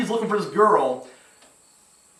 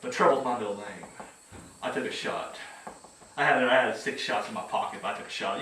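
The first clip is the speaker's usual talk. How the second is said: low, soft, half-whispered noir narrator; breathy private eye voiceover, low; noir detective; 7 semitones lower, 10 dB quieter than usual. Retold or imitated. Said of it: "You know, that kind of talk".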